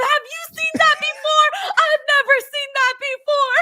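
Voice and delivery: high-pitched loud mock shriek; imitated fan screaming. Fan asking the guy in front of him, imitated scream. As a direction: demand it in an amazed mock scream. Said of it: "then he starts asking the guy in front of him".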